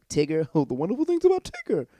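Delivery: high, loud, bouncy singsong; Tigger. Acted. Recapper showing Luke's happy face, bouncy and gleeful. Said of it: "happy to be like".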